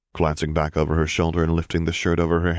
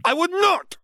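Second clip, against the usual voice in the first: very high, shrieked denial; piercing indignant outburst; outraged shriek. A narrator's reading. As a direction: shriek your denial at your sister.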